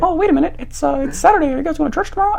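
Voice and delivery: very high squeaky falsetto; Mickey Mouse kid voice. Retold or imitated. Boy suspicious of church tomorrow, squeaky kid voice. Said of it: "I sounded like Mickey Mouse when I was a kid".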